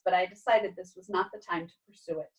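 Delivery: ordinary delivery, nothing marked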